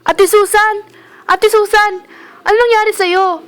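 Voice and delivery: high, loud frantic calls; panicked cry over a fallen coworker; alarmed shouting. Acted. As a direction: shout her name to wake her. Finds Susan collapsed, calling her.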